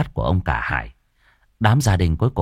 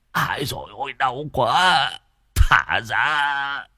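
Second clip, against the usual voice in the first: higher, tearful pleading; whimpering; 5 semitones higher than usual. A narrator's reading. Bound man begs the crowd to free him, whimpering. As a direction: blubber through pain and confusion. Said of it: "ông Hồng mếu máo".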